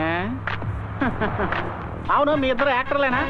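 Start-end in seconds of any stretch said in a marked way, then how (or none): 0.2-2.1 soft nervous laugh; nervous chuckle. Acted. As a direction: chuckle awkwardly to cover fear. Mocking the thugs. (భయంతో చిన్న నవ్వు)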